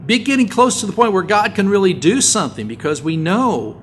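loud, high fervent preaching. Acted. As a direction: drive it home to the congregation. Preaching at full force.

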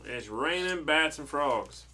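slow, loud storybook title; dramatic title announcement. Acted. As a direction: draw out the title for a small listener. Restarts reading with the title.

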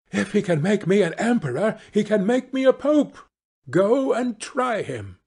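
high-pitched, bossy wife; insistent wife. Acted. Wife sending husband to the fish, insistent. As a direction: argue it high, then order him off.

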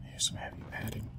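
unvoiced whisper. Real, not acted. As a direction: whisper it so the monster won't hear. Sneaking quietly.

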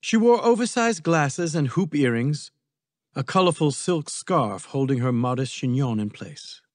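ordinary delivery

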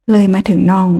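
plain reading voice

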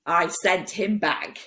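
plain reading voice

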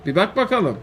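ordinary delivery

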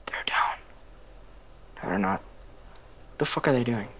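tense hushed whisper; whisper, barely breathing. Real, not acted. Hiding from enemies nearby.

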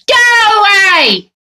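high slow shouted order; shouting. Acted. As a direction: bellow it, drawn out and high. Character chases someone off.

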